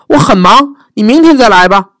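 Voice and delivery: high, loud dragon voice; grumpy dragon, get lost; dragon shooing her away. Acted.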